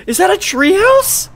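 high, loud thrilled question; excited. Real, not acted. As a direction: squeal with delight at a find. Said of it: "ignore how excited I just got".

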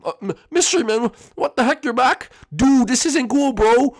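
put-on a scared little boy shouting in a strange place; panicked high kid voice. Kid voice again.

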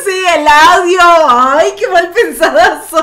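loud laughing protest, voice high and bursting. Real, not acted. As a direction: laugh it off at full volume, teasing. Host laughing at dirty reading. (riendo a carcajadas, protestando juguetona)

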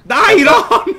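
high, loud urging yell; impatient shout. Real, not acted. Player hurries slow teammate along, shouting. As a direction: holler at a lagging teammate.

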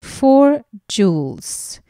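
ordinary delivery

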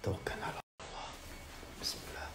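unvoiced whisper of protective verses; terrified whispered prayer; frightened whispered prayer. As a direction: pray under the breath for protection.

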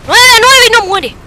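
high, loud screams; frantic yelling. Real, not acted. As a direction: scream as the match slips away. Tense close game.

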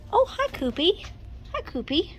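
high, sweet little hello; playful high-pitched baby talk; cooing greeting. Pretend. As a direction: coo hello as if to a pet. Greets a figurine like a pet.

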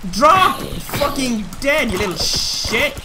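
loud angry yell at a mob; raging gamer. Real, not acted. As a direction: scream insults at the enemy. Yelling at mob.

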